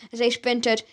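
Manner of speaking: ordinary delivery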